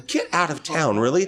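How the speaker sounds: high, incredulous playing along; mock disbelief. Man humoring lady's haunted house tale, high mock disbelief. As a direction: egg the storyteller on with fake astonishment.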